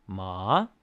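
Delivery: MA rising like a question; second tone. Shown as a demonstration. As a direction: slide ma upward in pitch. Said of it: "the second tone would be".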